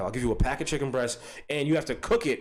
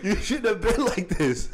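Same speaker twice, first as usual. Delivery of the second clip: slow mock scolding voice; 5 semitones higher than usual. Taunt repeated in a put-on voice.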